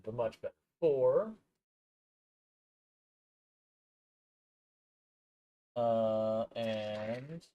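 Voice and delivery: muffled, off-mic and distant; talking away from the mic. Real, not acted. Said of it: "I do apologize for it being a little bit muffled".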